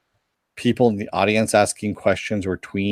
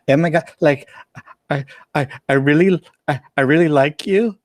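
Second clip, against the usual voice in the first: put-on tween girl voice; 7 semitones higher than usual. Imitating tween fans at panel.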